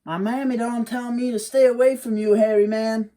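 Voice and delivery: put-on a cheeky boy calling down from a tree; Wiley taunting the hairy man from the tree, high and loud; defiant young Wiley. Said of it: "Wiley says".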